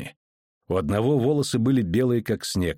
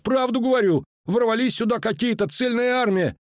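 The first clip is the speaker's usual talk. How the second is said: high-pitched insistent soldier; high, indignant protest; 13 semitones higher than usual. A narrator's reading. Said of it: "возмутился солдат".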